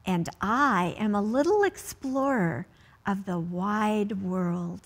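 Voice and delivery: slow, careful picture-book read-aloud; reading to children. Acted. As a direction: read each line slowly to a child at bedtime.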